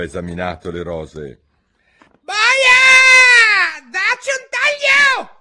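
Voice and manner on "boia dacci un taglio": very high, very loud screaming; furious scream. Acted. La regina urla la condanna a morte. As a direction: shriek the death order. Queen orders beheading.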